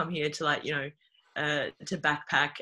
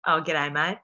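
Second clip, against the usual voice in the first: louder, broad Aussie caricature; broad Australian accent; 5 dB louder than usual. Acted. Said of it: "a pretty broad Australian accent".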